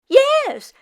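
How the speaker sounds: put-on excited old woman squeal; exclaiming. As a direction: shriek yes yes eagerly. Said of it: "Meshka exclaimed".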